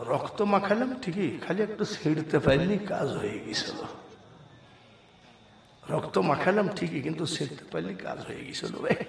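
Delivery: very soft, furtive mumble; hushed aside. Acted. Brothers privately regretting their botched trick, hushed aside.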